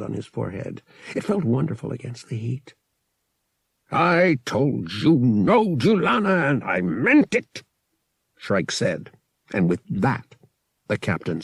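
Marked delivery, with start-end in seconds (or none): 3.9-7.6 raised, emphatic angry refusal. A narrator's reading. Captain refusing the Wookiee's plea, angry refusal. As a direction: put your foot down, pitch raised.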